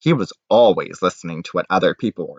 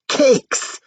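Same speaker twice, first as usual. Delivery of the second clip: delighted; 3 dB louder than usual. Acted. Anthony delighted by cakes.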